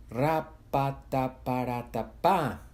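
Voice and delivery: slow drawn-out rat-a-tat of gunfire, spat out syllable by syllable; imitated gunfire. A narrator's reading. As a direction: act out machine-gun fire with the mouth, slowly. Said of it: "gritaban Adrián".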